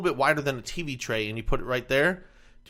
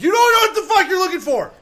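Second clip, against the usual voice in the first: loud, much higher scolding; exasperated outburst; 8 dB louder than usual. Retold or imitated. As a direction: snap at the picky customer. Scolding a picky customer.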